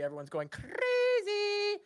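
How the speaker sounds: slow, high, stretched squeal; high-pitched drawn-out excitement; crowd gone wild. Acted. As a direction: let the last word soar up and hang. Announces crowd's wild reaction.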